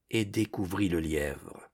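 plain reading voice

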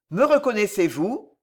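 plain reading voice

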